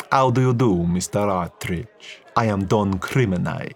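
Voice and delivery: slow, guarded Italian businessman; a smooth mobster sizing him up; Italian crime lord. Acted. Italian crime lord introduces himself.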